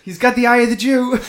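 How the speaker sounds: high, loud mock German; loud comic German villain line; fake German accent. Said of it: "They all talk with this".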